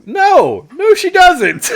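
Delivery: high, loud retold protest; exasperated objection. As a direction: cry out in comic disbelief, breaking into laughter.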